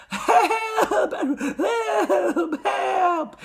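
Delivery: very high, drawn-out wail; piping, stretched cries; yelling for help. A narrator's reading. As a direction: wail it like a drowning boy. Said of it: "he yelled".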